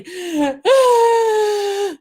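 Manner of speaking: high, loud drawn-out mock wail; gasp, then wail like an upset kid; imitated child's wailing. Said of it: "like that".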